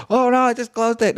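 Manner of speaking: high, loud oh no; sudden panic at a mistake; startled cry; surprised alarm. Real, not acted. Panics at closed window.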